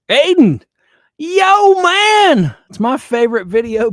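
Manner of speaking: high, loud greeting to a chat viewer; excited shout-out. Real, not acted. Greeting a viewer in the chat, excited call. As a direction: call out to Aiden with delight.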